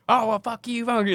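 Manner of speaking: high shrill-pitched mock outburst; yelling cussing. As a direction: spit it out high and furious. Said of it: "they start yelling and cussing at us".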